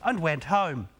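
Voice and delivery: ordinary delivery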